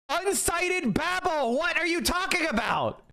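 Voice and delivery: incredulous, almost yelping; outraged outburst. Real, not acted. Reacting to the uncited claim, high outraged disbelief.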